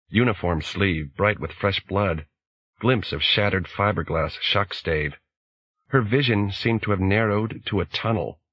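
usual speaking voice

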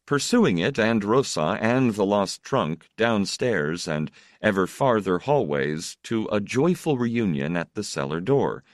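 ordinary delivery, nothing marked

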